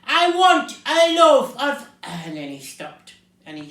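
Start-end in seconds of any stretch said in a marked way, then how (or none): 0.0-1.9 slow, high, full bellow cut short; top-of-voice shout. A narrator's reading. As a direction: bellow slowly, then break off. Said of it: "shouting at the very top of his voice".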